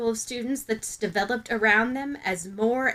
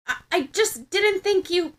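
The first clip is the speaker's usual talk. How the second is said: flustered stammer; 9 semitones higher than usual. Acted.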